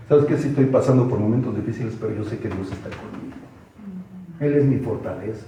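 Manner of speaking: composed, low-pitched assurance; confident attitude. Shown as a demonstration. Preacher shows how to answer in trials, confident attitude. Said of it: "pero con otra actitud".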